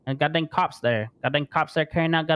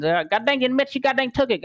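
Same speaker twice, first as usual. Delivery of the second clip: normal talking voice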